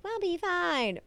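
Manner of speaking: high, soft, breezy brush-off; mock reassurance. Podcaster mimics characters dismissing cholera fears, mock-reassuring. Acts out breezy reply before disaster.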